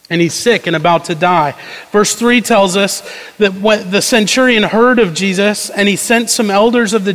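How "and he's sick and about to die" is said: normal talking voice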